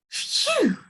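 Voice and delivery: high breathy sigh of relief; relieved phew. A narrator's reading. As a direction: let the fear go. Relief after fright.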